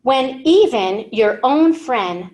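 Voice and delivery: usual speaking voice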